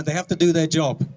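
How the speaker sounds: ordinary talk, nothing marked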